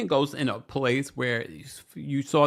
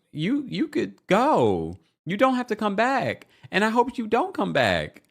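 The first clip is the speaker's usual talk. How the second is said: sassy dismissal; 11 semitones higher, 3 dB louder than usual. Real, not acted. Host talking back to Brittany on screen, sassy. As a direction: wave her off with sass.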